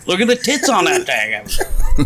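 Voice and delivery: ordinary delivery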